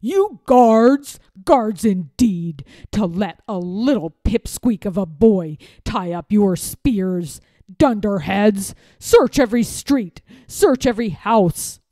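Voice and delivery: loud, furious bellow; old lord berating guards; angry bellow. A narrator's reading. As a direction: roar at the useless guards. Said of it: "bellowed the angry voice".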